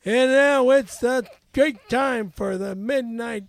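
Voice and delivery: loud sportscaster announcing; Howard Cosell. Shown as a demonstration. Announcing a midnight sports show, Howard Cosell. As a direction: belt it out like a big sports broadcast. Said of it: "Howard Cosell".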